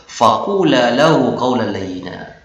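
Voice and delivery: slow, measured Quranic chant; Quran recitation. Acted. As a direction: recite the verse slowly and melodically. Quran verse quoted in lesson.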